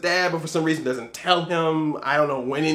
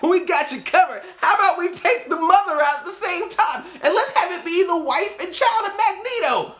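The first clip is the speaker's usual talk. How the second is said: higher, gleeful mock voice of the filmmakers; mock studio pitch; 10 semitones higher than usual. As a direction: pitch the cruel idea like a cheery studio exec. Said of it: "But X-Men Apocalypse was like".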